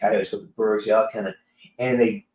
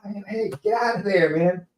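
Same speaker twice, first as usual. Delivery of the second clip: mock yell; 9 semitones higher than usual. Livestreamer retelling his urge to warn the rabbit, mock yell. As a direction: call out a warning in a put-on high voice. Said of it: "I felt yelling at the, at the rabbits".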